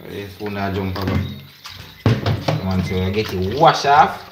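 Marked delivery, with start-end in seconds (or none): none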